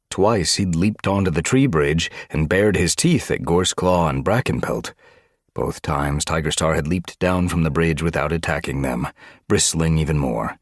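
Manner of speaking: ordinary delivery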